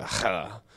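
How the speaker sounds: "ha" as a rasped guttural h; throaty Greek sound. Shown as a demonstration. Teacher showing the Greek sound, guttural "ha". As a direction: scrape the back of the throat on "ha". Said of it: "in there or something like that".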